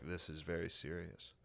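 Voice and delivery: low, slow, hushed solemnity; mock seriousness. Comic retelling how serious everybody was, mock solemn. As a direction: play it gravely, low and quiet. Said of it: "everybody was so serious about it".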